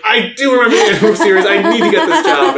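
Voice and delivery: excited quote. Retold or imitated. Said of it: "she was like".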